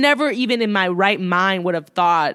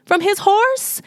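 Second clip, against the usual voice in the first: stunned disbelief; 10 semitones higher than usual. Retold or imitated. Old man in disbelief at a phone pulled out on horseback. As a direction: voice climbing higher into shocked disbelief. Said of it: "he's just like".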